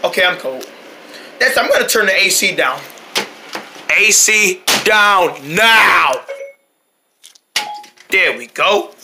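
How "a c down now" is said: slow, stretched command; drawn out. Acted. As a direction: stretch every word out. Drawn-out order.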